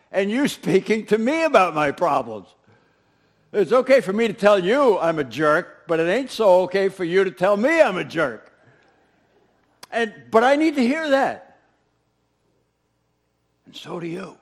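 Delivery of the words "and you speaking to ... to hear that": loud, driving sermon delivery; preaching at full force. Acted. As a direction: press the point hard on the congregation. Preacher's emphatic point.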